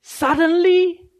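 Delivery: describing the blinding light; awed suddenly. Acted. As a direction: let the wonder burst out. Describing Jesus's booming appearance.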